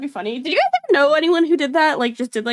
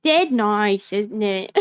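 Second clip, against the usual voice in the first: lower-pitched mock British accent; British accent imitation; 3 semitones lower, much slower than usual. Repeating the clip's British phrase, mock British accent. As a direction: mimic a British regional accent. Echoes the played British clip again.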